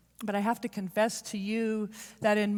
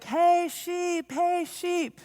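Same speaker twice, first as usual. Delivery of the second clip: high, loud call across a field; shepherd calling; 11 semitones higher, 8 dB louder, much slower than usual. Retold or imitated. Young shepherd calling his flock, in a high loud call. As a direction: call out to the flock so they hear you. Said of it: "And he's like".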